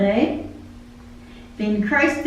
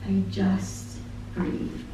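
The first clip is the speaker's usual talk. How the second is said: quiet, slow exhale of a line; a touch hushed and slowed; soft and slow; 2 semitones lower, 9 dB quieter, much slower than usual. Real, not acted. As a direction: say it slowly, softly, at rest. Closing reflection after lyrics.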